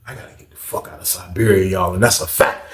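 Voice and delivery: ordinary delivery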